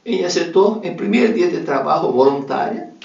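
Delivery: normal talking voice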